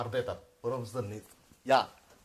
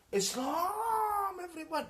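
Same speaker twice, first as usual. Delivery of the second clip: much higher, stretched proclamation; mock decree; much slower than usual. Mocking the Saud House decree, a high stretched proclamation.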